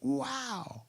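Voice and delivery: squeaky falsetto wow, way up high; an amazed little falsetto wow; mock amazed wow. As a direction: gasp it out in a tiny high voice of wonder. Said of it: "And I'm like".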